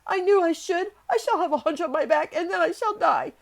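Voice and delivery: high, loud despairing wail; choked hysteria. A narrator's reading. Sick boy sure he will die, choked hysteria. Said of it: "choked Colin".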